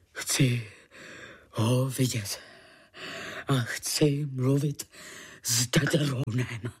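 low, heavy voice in halting bursts; restrained roar. A narrator's reading. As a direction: demand it in a deep voice, word by word. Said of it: "zařval Tulamor senior". (hluboký, zadržovaný řev)

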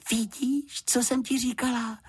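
very high, gleeful voice; delighted. A narrator's reading. Juditka once the door closes behind housekeeper, delighted. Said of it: "zaradovala se Juditka".

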